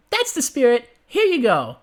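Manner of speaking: high, gleeful pony voice; gleeful Pinkie. Acted. Pinkie reassures her late friend, high and gleeful. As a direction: beam out every word, high and giddy.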